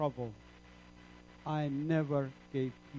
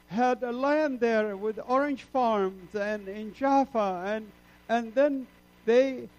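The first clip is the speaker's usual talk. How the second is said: higher and louder, crying out to the Lord; anguished protest; 7 semitones higher, 7 dB louder than usual. Retold or imitated. Refugee arguing with the Lord in anguish, crying out. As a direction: plead desperately, voice straining upward. Retold anguished argument with God.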